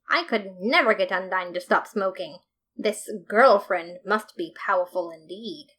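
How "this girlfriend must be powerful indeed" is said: plain reading voice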